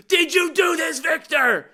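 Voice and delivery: high, loud dramatic trailer line; mock-dramatic accusing shout; Pedro Pascal line. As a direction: deliver it like the big trailer moment. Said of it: "magnificent line delivery by Pedro Pascal".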